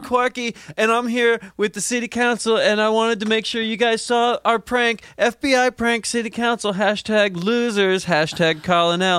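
influencer voice. Acted. Influencer records prank video.

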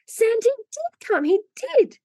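put-on very high, drawn-out child's cry; excited little child. A narrator's reading. Little Phronsie hugging her stocking, squealing with excitement. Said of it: "screamed Phronsie".

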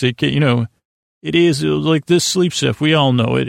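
ordinary delivery

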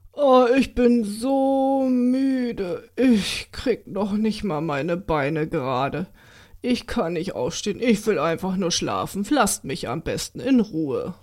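slow, heavy, yawning mule voice, barely awake; sleepy, drowsy. Acted. Mule drowsily begging Josef to let him sleep, slurred and slow. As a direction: drag every word like you're falling asleep. Drugged mule is too tired.

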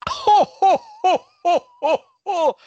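big booming high Santa laugh; belly-laugh it out as Father Christmas; loud jolly Santa chuckle. Acted.

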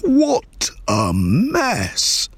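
slow, high wail of dismay; exasperated dad crying out; dismayed shout. A narrator's reading. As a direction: stretch each word in horror. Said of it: "shouted her dad".

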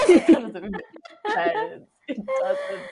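laughing through the words; cracking up mid-sentence. Real, not acted. Laughing at her own story.

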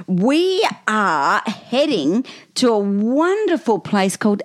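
slow, mock Texan drawl. Host announcing Dallas, hamming up a slow Texan drawl. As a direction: stretch it out like a Southern host. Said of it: "Do they talk like that?".

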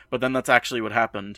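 ordinary talk

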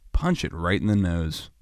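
low, soft, offhand tip; casual mock advice. Podcaster mocking survival advice on sharks, low and offhand. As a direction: toss it off low and easy.

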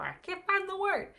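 high-pitched squeal of word-search frustration; playful exasperation. Real, not acted. Vlogger losing a word mid-explanation, exasperated and high. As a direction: jump up high, exasperated with yourself.

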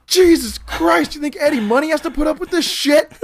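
high, strained yell in imitated outrage; Coconut Pete. Exasperated cook ranting at the new cooks, imitated high yell. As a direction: rant at the top of your range. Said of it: "still freaking out".

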